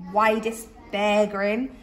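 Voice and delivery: ordinary delivery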